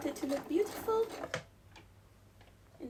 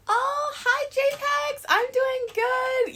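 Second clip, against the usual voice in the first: high and louder, stretched hello; bright loud greeting; 12 semitones higher, 10 dB louder, slower than usual. Real, not acted. Greeting a chat viewer late at night, loud and cheerful. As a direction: greet the viewer like a friend showed up.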